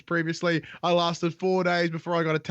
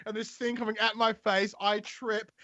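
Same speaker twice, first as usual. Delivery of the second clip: normal talking voice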